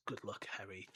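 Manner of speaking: low, soft murmur; murmured farewell. A narrator's reading. Narrator voicing Dumbledore's goodbye to baby Harry, murmured. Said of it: "he murmured".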